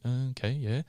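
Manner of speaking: softly retold, noncommittal nod-along; a quiet, half-following "oh, okay"; mild uncomprehending acceptance. Pilgrim hearing Italian he can't follow, softly going along. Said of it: "you're just kinda like".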